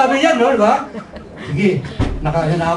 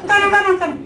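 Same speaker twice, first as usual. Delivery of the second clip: calling out; 12 semitones higher, faster than usual. Retold or imitated. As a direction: yell the directions across a noisy crowd. Girlfriend shouting directions.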